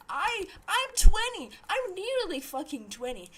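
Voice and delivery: loud, high indignant protest; indignant outburst. Real, not acted. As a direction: burst out in exasperation. Arguing back about age gap.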